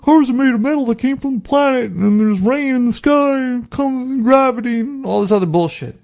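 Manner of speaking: high-pitched mock daydreamer voice; high put-on voice of wandering thoughts; chattering monkey mind. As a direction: ramble off in a high, silly voice. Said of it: "He's not thinking".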